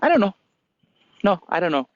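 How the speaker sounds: put-on high, dismissive old monkey; lightly voiced uninterested old monkey. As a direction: shrug it off as the monkey. Old monkey replies indifferently.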